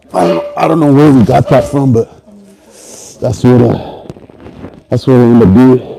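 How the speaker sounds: loud, pushing through a rep; strained loud talk. Real, not acted. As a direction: talk while straining under load. Loud mid-set talk while lifting.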